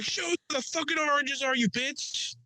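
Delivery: high, put-on tough cop voice; mock angry cop. Imagined cop yelling at him, high mock voice. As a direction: play a rough cop yelling.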